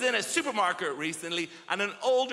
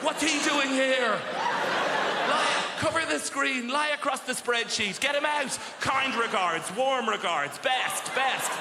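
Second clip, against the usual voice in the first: office panic; 6 semitones higher than usual. Retold or imitated. Office workers panic at surprise visitor, high mock panic. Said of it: "They're like".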